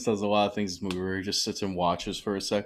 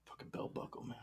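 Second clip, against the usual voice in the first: hushed, breathy aside; whisper; 19 dB quieter than usual. Real, not acted. Co-host whispers a jab at the belt buckle, hushed. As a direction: whisper the joke under your breath. Joking aside during movie recap.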